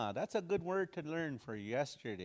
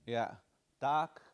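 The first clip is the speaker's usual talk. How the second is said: ordinary talk, nothing marked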